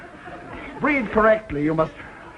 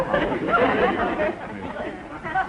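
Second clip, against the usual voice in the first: high, breathless squeak of a seal; seal imitation; 11 semitones higher, 4 dB quieter than usual. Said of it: "you know that sound they make?".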